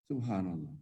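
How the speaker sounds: usual speaking voice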